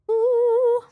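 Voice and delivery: high loud impressed ooh; excited ooh. Real, not acted. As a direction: coo with delight. Impressed by spell list.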